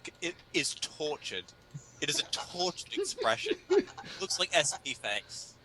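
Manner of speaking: ordinary talk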